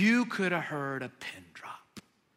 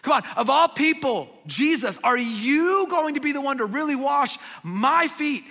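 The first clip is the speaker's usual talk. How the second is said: "you" punched high and loud in disbelief; emphatic you; 6 semitones higher, 6 dB louder than usual. Retold or imitated. Preacher retelling Peter's protest, leaning on "you". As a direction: push "you" like you can't believe it. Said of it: "The emphasis in the Greek is on you and my".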